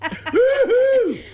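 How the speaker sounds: high whooping cheer; party whoop. Pretend.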